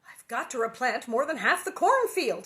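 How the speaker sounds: a tired farmer's exasperation, said forcefully; exasperated. A narrator's reading. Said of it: "He came back tired and exasperated".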